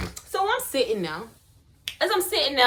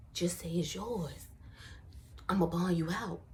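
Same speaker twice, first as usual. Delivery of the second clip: whisper; 7 semitones lower, 14 dB quieter than usual. Retold or imitated. Friend whispering to her in jail.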